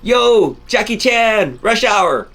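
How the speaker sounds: high-pitched, loud yell from a passing car; hammed-up street heckle; mock scream. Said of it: "screamed out the window and said".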